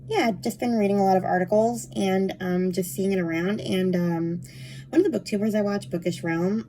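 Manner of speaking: ordinary delivery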